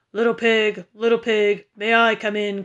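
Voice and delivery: loud wolf voice calling in. A narrator's reading. Wolf calls outside the brick house, loud menacing call. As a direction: call out loudly as the big bad wolf. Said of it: "called the big bad wolf".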